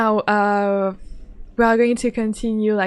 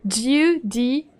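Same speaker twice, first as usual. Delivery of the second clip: normal talking voice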